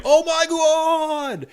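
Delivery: very high, long-drawn mock crowd cry; hammed-up crowd amazement. Imagined crowd reacts to the match announcement, exaggerated awe. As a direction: stretch it out high like a stunned arena. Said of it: "the crowd's gonna be like".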